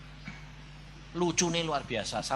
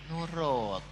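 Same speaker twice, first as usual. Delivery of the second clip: softer, drawn-out word; lowered, falling voice; gentle instruction; 6 dB quieter than usual. Acted. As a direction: ease off and say it softly. First take, lowered.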